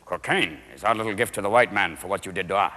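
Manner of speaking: low mock-solemn chief. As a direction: deliver revenge calmly and deep. Indian chief quote.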